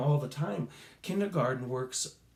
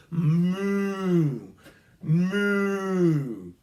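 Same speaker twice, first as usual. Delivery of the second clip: long, loud, higher mooing; cow moo; 4 semitones higher, 6 dB louder than usual. Shown as a demonstration. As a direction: stretch it out like a cow. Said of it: "and you were to rub outward, go".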